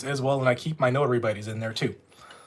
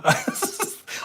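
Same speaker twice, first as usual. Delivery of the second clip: breathy laugh; 4 dB quieter than usual. Real, not acted. Laughing at a viewer's comment.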